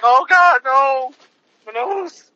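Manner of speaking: high-pitched mock whimper of a hurt cop; mocking whiny officer. Mocking the injured officer to his co-host, a high whiny wail. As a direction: play the tough cop crying like a baby, hammed up. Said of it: "he's like".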